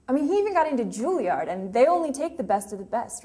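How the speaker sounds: loud, high, quick burst of pride; proud insistence. Acted. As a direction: insist on it, voice rising and racing. Grieving monologue, proudly insisting on his talent.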